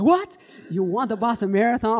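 high, loud incredulous outburst; imitating a flabbergasted listener; mock astonishment. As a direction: react as if stunned by the news. Acts out amazed reaction to joke.

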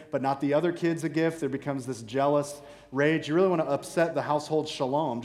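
ordinary talk, nothing marked

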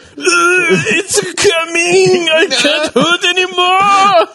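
high, slow strained whine through laughter; high strained groaning voice. Retold or imitated. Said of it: "he's like".

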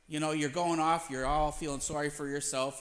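usual speaking voice

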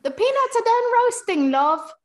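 put-on loud, very high British accent. Friend echoing the accent demo, loud high British accent.

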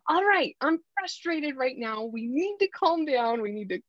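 mock raising the voice to settle things. Shown as a demonstration. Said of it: "I'm gonna raise my voice and be like".

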